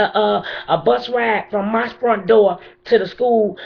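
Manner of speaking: pleading furiously with the mayor; impassioned angry plea. Real, not acted. Grieving mother confronts the mayor.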